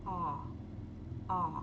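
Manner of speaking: "Ó" with a relaxed open mouth, between A and O; open aw vowel. Shown as a demonstration. Teacher modelling the vowel of water. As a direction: shape "ó" wide and unrounded. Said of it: "como se fosse um O com essa boca".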